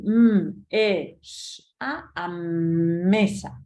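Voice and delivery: m, e, s, a stretched out slowly, then merged into "mesa"; slowly glue the sounds into one word; blending sounds slowly. Shown as a demonstration. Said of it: "tengo que integrar todos los sonidos".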